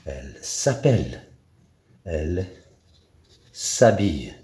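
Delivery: normal talking voice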